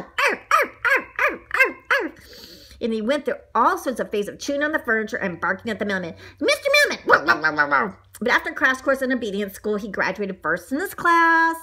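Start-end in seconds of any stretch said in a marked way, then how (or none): 0.0-2.1 high, yappy "arf" barks; little dog barking. Acted. 6.4-7.0 high, excited holler; calling at mailman. Acted. Donut barks at the mailman. 7.1-8.0 loud, repeated woofs; dog barking. Acted. Donut barks at the mailman.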